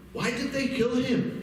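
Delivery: high, pleading disbelief; anguished question. Retold or imitated. Man asking the sheikh, high and disbelieving.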